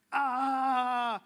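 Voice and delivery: a short, loud mock roar of energy; Joe's fired-up yell. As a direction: roar like a hyped friend. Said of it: "he's just like".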